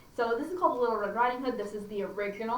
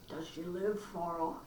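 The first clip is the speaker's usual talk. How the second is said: wolf; 5 semitones lower, 7 dB quieter, slower than usual. Acted. Wolf voiced low.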